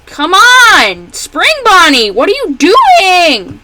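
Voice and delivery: high, loud and drawn out at the end; loud what-are-you-doing groan; exasperated yell. Real, not acted. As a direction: call out at the bunny in disbelief. Yelling at character in game.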